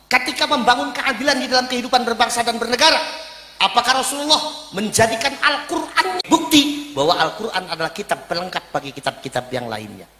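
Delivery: usual speaking voice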